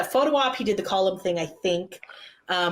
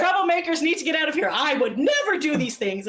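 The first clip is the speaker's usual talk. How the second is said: raised-pitch playful protest; mock outrage; 7 semitones higher than usual. [name] jokingly denouncing the troublemakers, mock outrage. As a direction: act scandalised, half joking.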